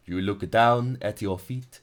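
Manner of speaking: ordinary talk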